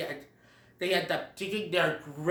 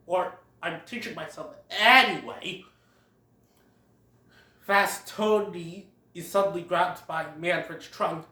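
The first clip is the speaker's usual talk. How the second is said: ordinary delivery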